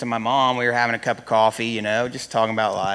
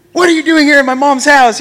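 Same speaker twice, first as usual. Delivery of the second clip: much louder and higher, yelling in his face; retold scream; 13 dB louder than usual. Man screaming at the intruder in his mom's house, loud. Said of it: "I was screaming at him".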